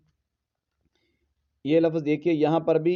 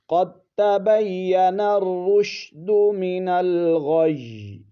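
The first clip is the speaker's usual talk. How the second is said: slow melodic chant, higher; Quranic recitation; 4 semitones higher, 3 dB louder, much slower than usual. Shown as a demonstration. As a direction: draw out the verse tunefully. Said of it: "اس طرح تلفظ کریں گے".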